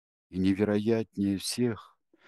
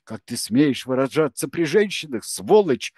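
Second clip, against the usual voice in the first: higher and louder angry rebuke; furious woman shouting; yelling; 6 semitones higher, 7 dB louder than usual. A narrator's reading. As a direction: bawl him out for swearing. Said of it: "заорала она".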